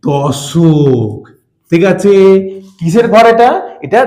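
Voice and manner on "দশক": দশক stretched very slow and low; দশক pronounced slowly, long and drawn out; drawn-out key word. Shown as a demonstration. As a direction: stretch out দশক so the class hears it. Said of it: "এককের পর হচ্ছে".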